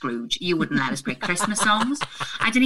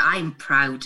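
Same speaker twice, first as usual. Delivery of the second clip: normal talking voice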